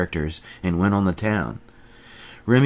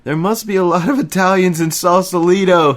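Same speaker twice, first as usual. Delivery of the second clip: much higher, louder mocking shout; shouting at the top of his lungs; 10 semitones higher, 6 dB louder than usual. A narrator's reading. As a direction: bellow it back, delighted. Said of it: "he shouted at the top of his lungs".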